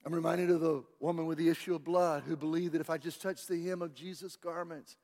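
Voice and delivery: usual speaking voice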